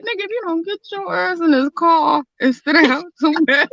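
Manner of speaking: mock denial. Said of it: "I was like".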